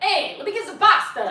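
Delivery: loud, high mock Italian accent; imitated Italian voice. Mimicking her date ordering from the waiter, loud Italian voice. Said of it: "there was an Italian voice".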